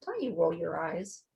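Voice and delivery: ordinary talk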